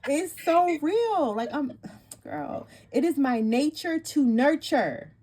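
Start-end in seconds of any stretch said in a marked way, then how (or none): 0.1-1.3 excited. Real, not acted. Thrilled by the reading.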